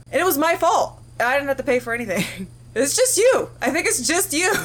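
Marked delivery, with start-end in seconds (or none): 2.8-4.5 laughing, high and louder; high laughing jab at a friend; teasing laugh. Real, not acted. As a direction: tease him through laughter.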